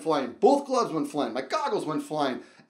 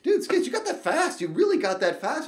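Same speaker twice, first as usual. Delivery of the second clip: high excited bro voice, higher; friends hyping him up; 11 semitones higher, 3 dB louder than usual. Retold or imitated. Friends cheering him down the slope, excited. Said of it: "my friends were like".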